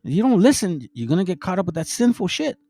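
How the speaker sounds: a hint of a big brother saying just do right; friendly advice. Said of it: "He says".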